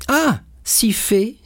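high, bright exclamation of recognition; surprised recognition. Acted. Edmond suddenly recognizing Fernand, pleased surprise. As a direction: suddenly place the face.

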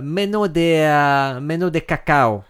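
slow hammed-up fake Italian; mock Italian company rep; fake Italian accent. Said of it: "tu parles très bien le faux italien".

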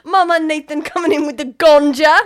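loud, hammed-up British street accent. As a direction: belt it out in mock British. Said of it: "it's the same".

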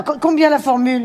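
ordinary talk

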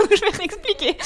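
high, laughing words. Real, not acted. Guest to host about re-explaining to absent listeners, laughing. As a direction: say it through a real laugh.

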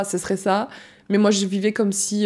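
ordinary talk

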